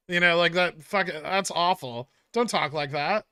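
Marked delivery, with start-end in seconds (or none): none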